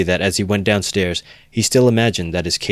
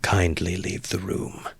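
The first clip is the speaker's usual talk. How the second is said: soft, slow, deliberate order; hushed, controlled command; quiet and distinct; 6 dB quieter, slower than usual. A narrator's reading. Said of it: "he said quietly and distinctly".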